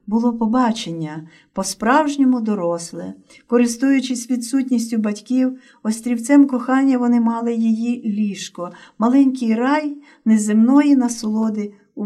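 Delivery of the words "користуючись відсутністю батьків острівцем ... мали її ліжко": usual speaking voice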